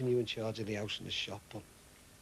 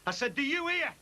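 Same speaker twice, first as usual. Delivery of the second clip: much higher, louder repeat; furious repeat; 4 dB louder than usual. Acted. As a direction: shout the question again.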